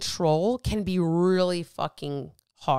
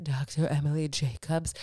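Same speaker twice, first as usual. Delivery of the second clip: lower, put-on announcer-style name; grand name voice; 5 semitones lower, 3 dB quieter than usual. Shown as a demonstration. Doctor's name said in a lower, put-on grand voice. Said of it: "I don't know why I have to say her name like that".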